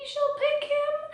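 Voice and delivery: maybe she'll pick him, floated up high and slow; high hopeful aside. Real, not acted. Vlogger teasing viewers about Mike's chances, high and slow.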